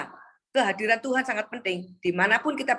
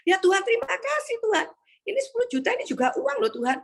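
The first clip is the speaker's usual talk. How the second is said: high-pitched mock-crying thanks to God; acted tearful wail, high; tearful prayer; 11 semitones higher than usual. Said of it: "[name] bisa nangis".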